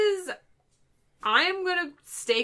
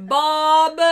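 calling out; 8 dB louder than usual. Pretend. Calling for Bob in bit.

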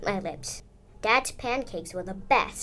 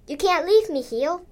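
high, rising protest; indignant kid objecting to a parent; 6 semitones higher, 5 dB louder than usual. A narrator's reading. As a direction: plead your case, building louder and higher. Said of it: "I protested".

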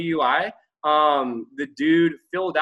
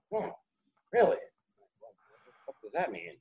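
very quiet, low disbelief; stunned, hushed reply; 5 semitones lower, 8 dB quieter than usual. Retold or imitated. Client hearing the arresting officer got fired, hushed disbelief.

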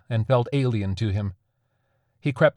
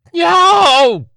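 high yelp instead of a war cry, much louder; failed war cry; 14 dB louder than usual. A narrator's reading. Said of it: "emitted what he hoped was a frightening war cry".

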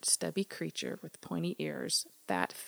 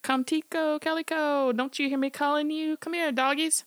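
much higher, loud desperate call; calling again; 10 semitones higher, 5 dB louder than usual. Acted. Smitty calls again for dogs that won't come, desperate high holler. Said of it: "So he called again".

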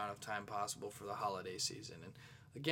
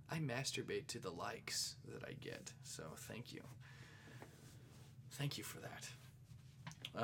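whispered, much softer, the last thanks slowed right down; 9 dB quieter, slower than usual. Real, not acted. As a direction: hush it like a guilty secret. Joking confession to listeners.

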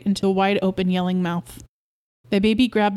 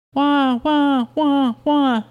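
higher, louder imitated infant crying; mock baby wail; 6 semitones higher, 4 dB louder, much slower than usual. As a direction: wail like a baby pulled off the bottle. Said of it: "the baby yelled".

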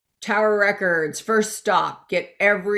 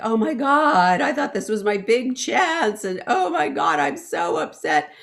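loud mock sob, voice raised high; retold weeping; 5 semitones higher, 4 dB louder than usual. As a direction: replay the tearful panic, half-acted. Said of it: "I'm weeping".

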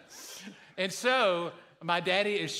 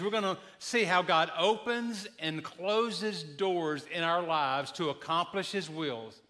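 normal talking voice

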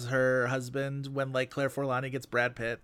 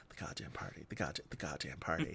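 low, near-whispered, much softer; many emotions; 10 semitones lower, 15 dB quieter than usual. Retold or imitated. Actress trying every emotion on one phrase, whispered low. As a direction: cycle through emotions under the breath. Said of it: "She just keeps being like".

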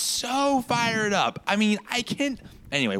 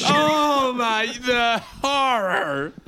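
mock anguish; 6 semitones higher, 4 dB louder, much slower than usual.

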